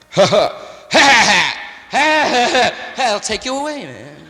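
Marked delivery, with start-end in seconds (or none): 0.1-2.9 loud, forced, hammed-up ha ha ha; overdone, slowing fake laughter; fake laughs. Shown as a demonstration. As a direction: show how hollow a faked laugh is. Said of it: "Try to fake four laughs in an hour".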